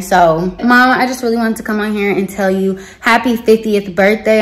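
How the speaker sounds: ordinary talk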